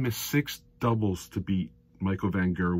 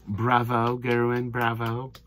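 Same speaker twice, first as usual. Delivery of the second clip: deadpan, monotone mock cheer; sarcastic praise; slower than usual. Crowd supposedly answering the pantomime villain, sarcastic praise. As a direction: drone the praise on one level note.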